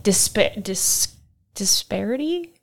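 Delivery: halting, stumbling on the word; stuttering. Real, not acted. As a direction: feel for the word, restarting, then lift it like a question. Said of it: "like, stutterings in there".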